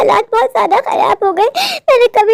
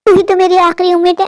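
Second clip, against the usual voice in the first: usual speaking voice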